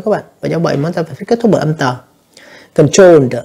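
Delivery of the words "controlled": Controlled with a voiced "duh" at the end; emphatic model of Controlled, ending on d; final D sound. Shown as a demonstration. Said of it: "âm đờ nha các bạn".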